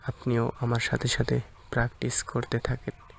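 ordinary talk, nothing marked

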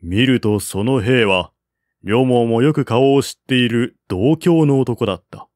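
usual speaking voice

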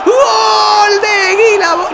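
slow, high, shouted goal call. Real, not acted.

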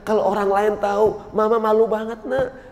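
put-on high-pitched mother pleading with her child; acted motherly lament, rising high; distressed mother. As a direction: plead like an embarrassed mum. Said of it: "Ada ibu punya anak, anaknya nakal banget".

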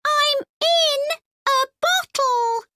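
a frightened little robot; scared robot voice. Acted. Robot trapped.

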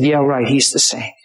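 lightly voiced Satan, low dismissal; scoffing Satan. Satan telling God men are selfish, lightly scoffing. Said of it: "And Satan said".

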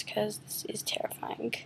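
scared hushed whisper. Real, not acted. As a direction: breathe it out, frightened. Scared of descending into dark water.

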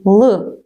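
Л as a dark, unsoftened L; hard L. Shown as a demonstration. Teacher isolates the first sound of ласти, hard L in Л. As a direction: sound out Л hard, without a y-glide. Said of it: "Так, твердий".

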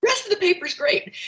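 high-pitched mock verdict on the paper; imitating husband's teasing critique, pitched high; retold joking critique.

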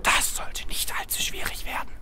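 hushed, breathy whisper; conspiratorial whisper. Acted. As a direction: keep it secret, barely voiced. Secret plan, whispered aside.

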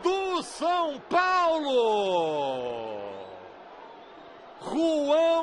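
very long drawn-out goal cry; goal shout. Real, not acted. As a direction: hold the goal call, then shout the name. São Paulo winner being called.

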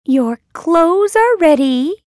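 high, loud storybook mouse; mouse character voice. A narrator's reading. Mouse announcing the wedding clothes, high loud voice.

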